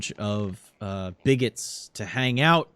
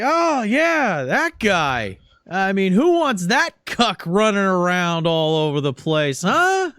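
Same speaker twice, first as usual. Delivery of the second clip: much higher, louder mocking jeer; sneering congressman; 7 semitones higher, 5 dB louder than usual. Congressman ridicules the costumed guy before the crowd. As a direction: ridicule him in front of the crowd.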